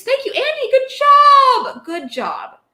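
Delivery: high, sarcastic sing-song thanks; mock praise. Streamer mocking a chatter's citation, high sarcastic praise. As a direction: gush over Andy's citation with fake delight.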